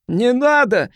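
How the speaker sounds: high, desperate plea; pleading. A narrator's reading. Cornered man begging the advancing crowd, pleading. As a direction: beg them to stop. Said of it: "взмолился он".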